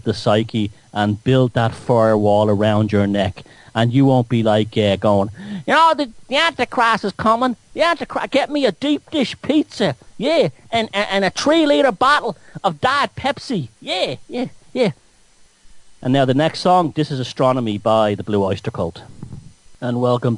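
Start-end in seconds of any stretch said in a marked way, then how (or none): 5.7-14.9 mock Southern believer. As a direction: parody a dim believer, pitched way up. Mocking believer ordering pizza, Southern hick voice.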